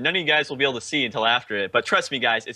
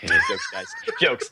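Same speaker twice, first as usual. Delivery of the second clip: laughing it off, high; squeaky laugh through the words; slower than usual. Real, not acted. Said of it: "it got really quiet".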